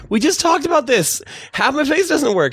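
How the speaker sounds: high protest, slowing at the end; retold exasperation. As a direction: explain it in comic frustration.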